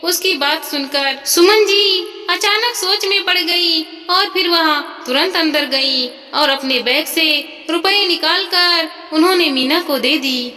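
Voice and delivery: ordinary delivery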